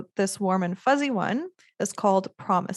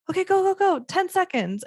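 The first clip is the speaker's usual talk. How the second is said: cheering them on; 11 semitones higher than usual. Retold or imitated. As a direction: hype them up like a countdown cheer. Cheering couple on during kisses.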